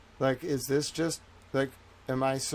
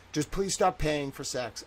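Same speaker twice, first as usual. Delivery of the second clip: usual speaking voice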